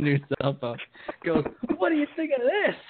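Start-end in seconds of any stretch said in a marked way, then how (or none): 1.8-2.8 high mocking taunt; impersonating the taunting ex. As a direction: sneer it up high like the ex. Ex taunting before smashing phone.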